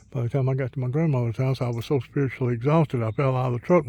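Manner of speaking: ordinary talk, nothing marked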